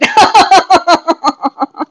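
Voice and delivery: loud high burst of laughter. Real, not acted. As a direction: laugh hard at your own joke. Laughing at her guy joke.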